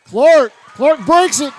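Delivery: loud, high breakaway call; rising shout as he breaks free; excited call. Real, not acted. Play-by-play on a breakaway run.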